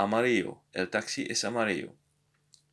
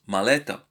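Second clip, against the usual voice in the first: "maleta" with a louder, stressed LE; stress on penultimate syllable; 5 dB louder than usual. Shown as a demonstration. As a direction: punch the middle syllable of "maleta". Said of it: "second to last syllable usually receives".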